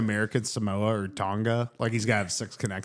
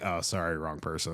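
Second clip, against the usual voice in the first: mock apology; 7 semitones lower, 7 dB quieter than usual. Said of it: "be like".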